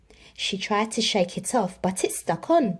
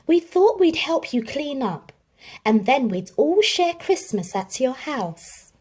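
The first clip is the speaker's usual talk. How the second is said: friendly neighbours; 6 semitones higher than usual. A narrator's reading. Neighbours offering to share Christmas, cheerful. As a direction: kindly invite. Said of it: "They said".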